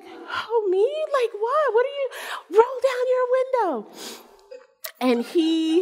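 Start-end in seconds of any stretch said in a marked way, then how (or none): 0.0-2.1 high, softened who-me protest; mock confusion. Man in next car acting innocent, confused squeak. Acts Bob playing confused. 2.6-3.7 high-pitched, cutting-in mock yell; insistent holler. Driver interrupting man in next car, insistent holler.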